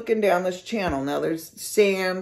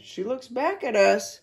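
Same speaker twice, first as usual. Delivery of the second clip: ordinary delivery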